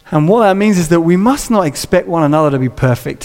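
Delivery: normal talking voice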